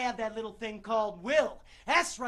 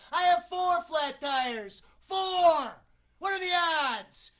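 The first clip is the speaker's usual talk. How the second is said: high, loud disbelief, drawn out; exasperated outrage; 7 semitones higher, 8 dB louder, slower than usual. Acted. Actor finding four flat tires before callback, exasperated outburst. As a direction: cry out at the absurd bad luck.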